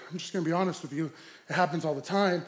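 low-key, softly spoken aside; confiding officer. Retold or imitated. Cop being honest with the young driver, quietly confiding. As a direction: let him in on it quietly.

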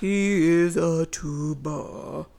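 slow, deep mock tuba voice; really low voice. Joining in as the tuba, slow deep voice. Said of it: "He talks really low".

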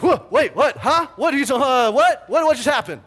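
high, loud startled yelps; shocked panic. Retold or imitated. Husband jolted awake by the news, panicking loudly. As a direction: blurt it out in sudden alarm. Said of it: "I'm like".

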